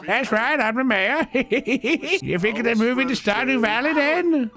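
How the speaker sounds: high, laughing small-town mayor; jolly mayor voice. Acted. Mayor greeting a newcomer to town, high and laughing. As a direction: play the beaming mayor welcoming a stranger.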